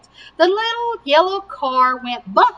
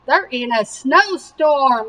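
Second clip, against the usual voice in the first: plain reading voice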